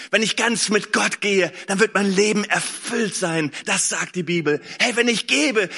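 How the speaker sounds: rising fervent preacher voice; preaching. Real, not acted. Preacher stirring the congregation to trust God. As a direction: build it like a sermon.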